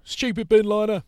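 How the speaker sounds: high, loud jeering call; high mocking shout of a passer-by; mocking heckle. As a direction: jeer it like a cheeky fan. Heckler mocking bin liner coat.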